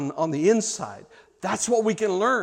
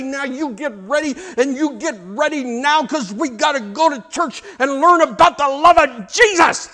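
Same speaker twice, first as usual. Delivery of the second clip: high, loud scolding parent; hammed-up furious parent; angry parent; 7 semitones higher, 6 dB louder than usual. Parent scolds the kid who drags their feet, angry. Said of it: "grab this person ... by the throat and you say".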